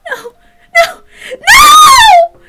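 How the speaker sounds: put-on high, loud panicked scream in a girl's voice. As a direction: scream in terror, voice breaking. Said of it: "she screamed".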